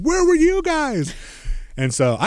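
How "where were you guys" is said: much higher, slow indignant comeback; mock defensive retort. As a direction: toss it back at them, indignant. Her retort to angry friends.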